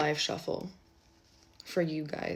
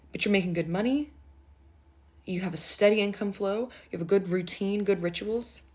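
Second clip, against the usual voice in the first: ordinary delivery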